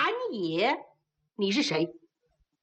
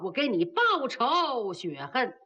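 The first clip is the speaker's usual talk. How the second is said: ordinary delivery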